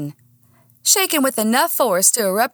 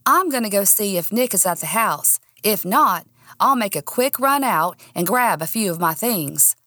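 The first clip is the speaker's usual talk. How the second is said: plain reading voice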